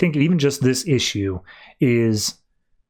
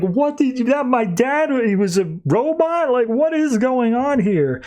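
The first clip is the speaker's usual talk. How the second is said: freaking out; 10 semitones higher than usual. Retold or imitated. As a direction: voice Matt's panic in a squeaky rush. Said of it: "Matt's freaking out".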